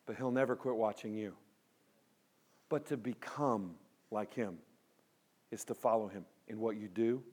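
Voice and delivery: soft, low, measured exhortation; hushed, earnest preaching. Acted. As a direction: speak gently with weighty pauses.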